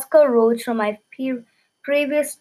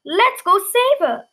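rallying; 8 semitones higher, 4 dB louder than usual. Acted. King rallies the crowd.

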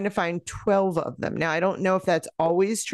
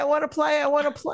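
whiny insistence; 10 semitones higher, faster than usual. Real, not acted. Impatient to start the game.

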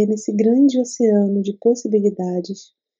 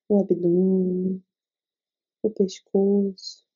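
guided meditation. Acted. Leads a meditation.

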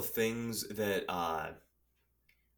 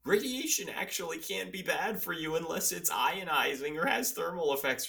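high, smug know-it-all voice; mock the experts in a high voice; 10 semitones higher than usual. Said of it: "people in the article were just doing the".

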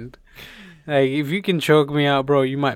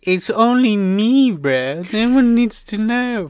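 slow, higher and louder reassurance; mock conspiratorial; 8 semitones higher, 6 dB louder, slower than usual. Real, not acted. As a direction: drag it out with a laugh in the voice. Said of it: "realised I'm doing a podcast".

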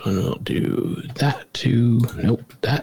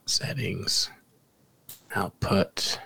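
whispering; 4 semitones lower, 7 dB quieter, much slower than usual. Real, not acted. Muttering while setting up.

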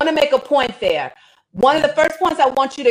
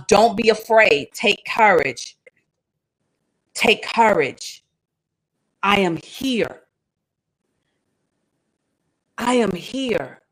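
reassuring; 2 semitones lower, slower than usual. Acted. Voicing Jesus calming the disciples.

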